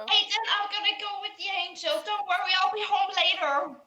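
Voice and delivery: put-on high, loud, quick childlike chirp; cheery little girl. Girl tells Aiden she is leaving with angel, high and cheery. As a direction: call it out fast in a high girlish voice.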